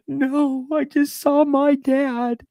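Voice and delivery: high, slow tearful wail; acted-out bawling. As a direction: wail it through imitated sobs. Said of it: "I started bawling".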